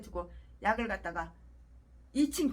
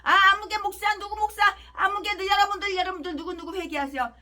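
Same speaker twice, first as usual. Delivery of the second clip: mock preaching; 6 semitones higher, 4 dB louder than usual. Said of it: "강대상에서 설교할 때는".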